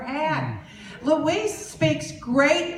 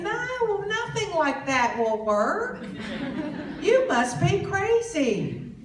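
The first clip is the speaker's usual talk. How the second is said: scoffing disbelief; 7 semitones higher, slower than usual. Retold or imitated. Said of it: "And I'm thinking".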